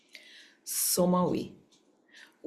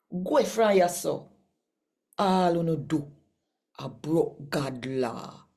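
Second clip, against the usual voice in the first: higher, measured Bible reading voice; scripture reading; 6 semitones higher than usual. Acted. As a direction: read the passage aloud like scripture to a congregation. Reads scripture passage aloud.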